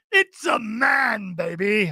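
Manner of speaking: put-on slow, high, shouted British bloke accent; a deliberately bad British accent, slow and yelled; mock British man. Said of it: "a fantastic male and an even better female".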